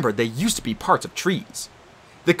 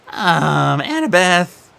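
nervous; 5 semitones higher, 5 dB louder, much slower than usual. Acted. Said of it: "Grover cut in nervously".

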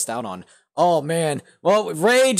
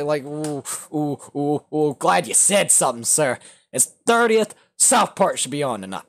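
ordinary talk

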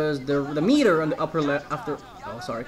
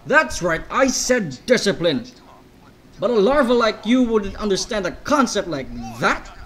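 loud, scornful Russian-style general; louder, berating a lowly recruit; angry general; 5 semitones higher, 7 dB louder than usual. Acted. General scolds the player.

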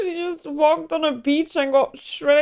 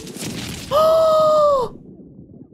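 a loud, high gasp that falls away; shocked gasp; 4 dB louder than usual. Real, not acted. Reacting to brutal beach landing.

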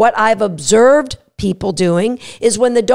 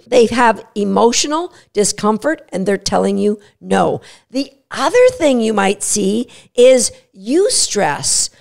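usual speaking voice

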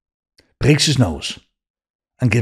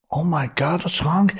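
alarmed; 7 semitones higher than usual. Retold or imitated. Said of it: "And I went".

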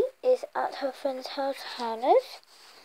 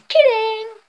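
loud; 9 semitones higher, 11 dB louder than usual. Real, not acted. Breaks from spooky act.

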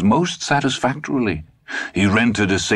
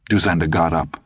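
plain reading voice

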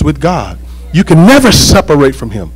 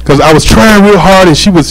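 normal talking voice

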